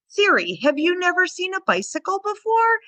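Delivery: high, sing-song puppy talk; jollying the puppy. Retold or imitated. Trainer soothing a barking puppy, high jolly baby talk. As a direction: cheerfully coo to the puppy that a bike is nothing scary. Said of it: "So I'm jollying the puppy".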